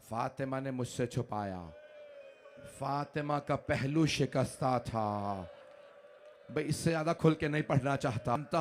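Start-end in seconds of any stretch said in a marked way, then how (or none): 0.1-5.3 soft, hushed, slow admission; quiet, broken reply; grieving confession. Acted. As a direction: confide the wound softly. Ali's grieving answer.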